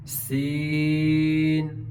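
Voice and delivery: سین with a light hissed s and long held ee; slow stretched سین, light s; thin, whistled سین. Shown as a demonstration. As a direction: hold the vowel of سین, never full-mouthed. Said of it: "کو پر نہیں پڑھیں گے".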